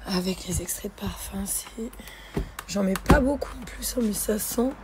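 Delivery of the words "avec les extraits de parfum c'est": whispering. Real, not acted. Savouring laundry smell.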